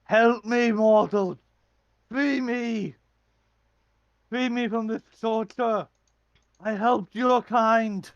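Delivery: loud, high, pleading giant cry; desperate titan. Acted. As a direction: bellow it like a chained titan in agony. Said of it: "he goes".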